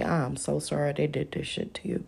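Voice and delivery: hushed, breathy apology; whispered sympathy. Retold or imitated. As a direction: whisper it like a sorry lawyer. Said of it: "He gonna say".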